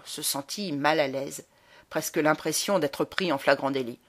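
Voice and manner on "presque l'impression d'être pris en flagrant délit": plain reading voice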